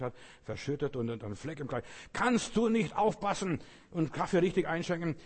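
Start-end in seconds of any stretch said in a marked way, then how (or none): 2.1-5.2 higher, louder snapping reproach; irritated scolding. Retold or imitated. Guest scolding the girl who spilled coffee, sharp and irritated.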